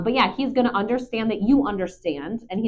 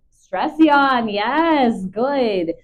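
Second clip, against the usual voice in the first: loud, raised praise for the dog; excited praise; 5 semitones higher, 6 dB louder, much slower than usual. Real, not acted. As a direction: cheer him on the moment he yawns. Praising the dog's stress yawn.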